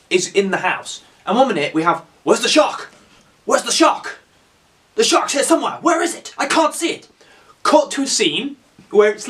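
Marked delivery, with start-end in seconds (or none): 2.2-7.1 high mock-panicked voice; melodramatic, rising panic; frantic searching. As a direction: hunt for it in hammed-up alarm. Acting out frantic search in house.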